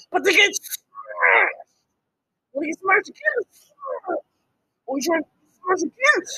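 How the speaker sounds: usual speaking voice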